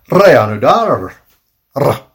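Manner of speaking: REANUDAR slow and loud with trilled R's; rolled R's. Shown as a demonstration. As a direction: roll the R's hard in "Reanudar". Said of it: "rolling our R's".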